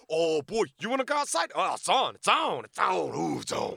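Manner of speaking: high, loud hyped-up taunting; hammed-up high, loud brawler taunt; fake tough act. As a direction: puff up like you want the fight. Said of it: "I put the whole act on".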